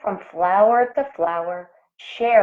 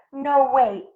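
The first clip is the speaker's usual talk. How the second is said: ordinary delivery, nothing marked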